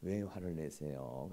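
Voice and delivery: very soft, low, slow question; gentle concern. Acted. Husband calming his angry wife, tenderly soft. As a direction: soothe them with a hushed question. Models calm reply to anger.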